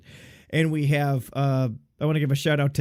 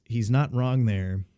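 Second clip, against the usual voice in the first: ordinary talk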